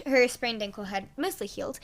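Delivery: ordinary delivery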